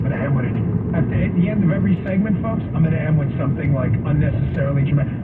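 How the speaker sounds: ordinary talk, nothing marked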